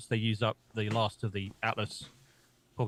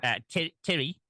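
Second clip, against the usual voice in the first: attempted Kiwi accent, higher; 5 semitones higher than usual. Shown as a demonstration. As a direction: say Terry with a weak New Zealand accent. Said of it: "I was trying to do a Kiwi accent".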